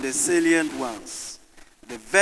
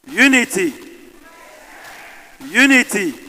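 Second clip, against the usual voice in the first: loud, raised call, falling at the end; rallying call; 5 semitones higher, 7 dB louder than usual. Real, not acted. Moderator calls to the audience for a response, calling out. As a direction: throw it out for the crowd to answer.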